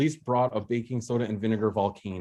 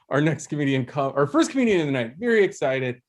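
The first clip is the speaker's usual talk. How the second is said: comedy host introduction; 3 semitones higher than usual. Real, not acted. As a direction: build up to the comedian's name.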